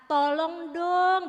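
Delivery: drawn-out begging "tolong dong", very slow; pleading. Shown as a demonstration. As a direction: plead for help, stretching "tolong dong" out slowly. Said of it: "dengan memelas". (memelas, "tolong dong" diucapkan sangat pelan)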